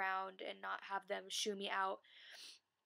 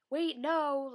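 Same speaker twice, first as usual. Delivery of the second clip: urgent call; 6 semitones higher, 8 dB louder than usual. Pretend. Stopping Jared as he passes.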